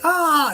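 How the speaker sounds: drawn-out high "ah" of retold terror; imitated cry. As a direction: cry out like someone vanishing. Astronaut cries out as he disappears.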